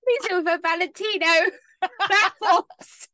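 high, loud giggly words; laughing while talking. Real, not acted. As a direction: speak through the giggles.

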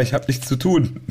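ordinary talk

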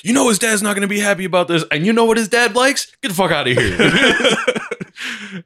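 high-pitched comic tirade; hammed-up confrontation. Shop owner throwing out the thief, hammed-up confrontation. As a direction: riff it high, then stretch out the dismissal.

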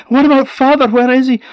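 high, loud anxious question; urgent and worried. A narrator's reading.

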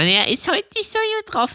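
put-on high, thin old woman voice; old lady voice. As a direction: play a frail old lady. (Stimme einer alten Dame)

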